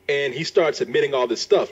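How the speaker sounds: ordinary delivery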